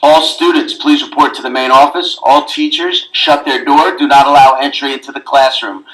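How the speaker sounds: normal talking voice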